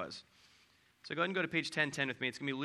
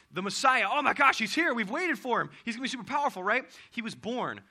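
excited shepherds; 6 semitones higher, 5 dB louder than usual. Retold or imitated. Shepherds react again.

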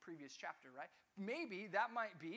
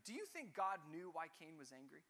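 ordinary delivery

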